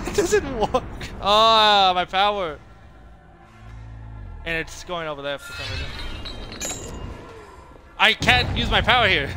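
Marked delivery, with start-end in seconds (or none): 0.1-2.6 high, loud cry of dismay; exasperated wail. Real, not acted. Player lamenting that the power doesn't work, exasperated wail. 8.0-9.3 loud, high-pitched protest; exasperated complaint. Real, not acted. Player protesting a useless power mid-match, exasperated.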